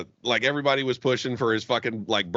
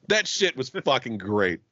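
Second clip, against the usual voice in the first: ordinary talk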